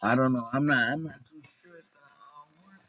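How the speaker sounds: ordinary talk, nothing marked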